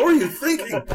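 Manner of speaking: high, loud mock-horrified echo; mock-alarmed echo. Real, not acted. Echoes the tease in mock alarm.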